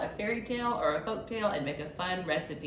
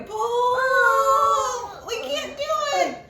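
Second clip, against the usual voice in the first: very high, loud strained pull; straining cry; 6 dB louder, much slower than usual. Acted. Librarian wailing the pull for the child, very high and loud. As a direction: heave and wail.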